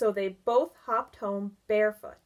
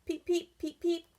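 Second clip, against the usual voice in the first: frog peeps; 8 semitones higher than usual. Acted. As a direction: chirp like a tiny spring frog. Frog sound effect in story.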